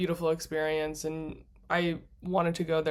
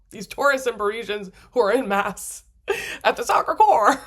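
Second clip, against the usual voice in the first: louder, through real laughter; laughing; 4 semitones higher, 8 dB louder than usual. Real, not acted. Traveler recalls crying in front of mass-goers, laughing. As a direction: break into laughter at your own embarrassment.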